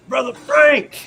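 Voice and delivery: loud, high-pitched greeting; hearty shout-out. Real, not acted. Host greeting Brother Frank, calling out.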